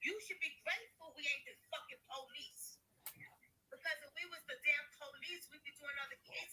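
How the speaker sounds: hushed muttered retake; quiet repeat. Shown as a demonstration. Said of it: "repeats the line much softer".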